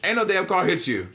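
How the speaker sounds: mock-exasperated parent voice; dismissive parent. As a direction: imitate a parent who believes none of it.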